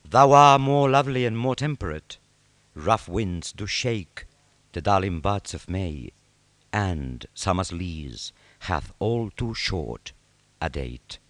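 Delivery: slow, savoured sonnet reading; poem recitation. Acted. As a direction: dwell on each word of the verse.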